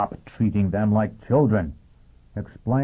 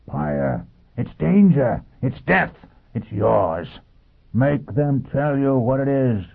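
short exclaimed warnings to a crowd; urgent street-corner exhortation, mostly pitched up; Foyle bellowing; 5 semitones higher, slower than usual. A narrator's reading. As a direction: cry out each warning separately. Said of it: "Foyle bellowed".

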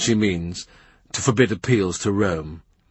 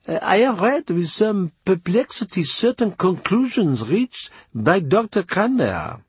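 high, careful foreign envoy voice; Chapuis voice; 10 semitones higher than usual. A narrator's reading. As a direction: Chapuis speaking with courtly hesitation. Narrator voices the ambassador.

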